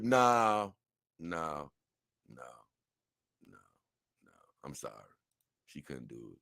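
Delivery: trailing off into near silence; dropping to almost nothing; fading no. Real, not acted. Embarrassed reaction to crude comment.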